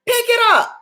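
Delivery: rising preacher's call to action; preaching urgently. Real, not acted. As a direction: command them to pick it back up. Preacher urging the congregation.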